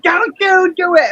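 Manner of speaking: high-pitched mock warning at a bug; mild mock yell, high; retold yelling. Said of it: "What are you yelling at?".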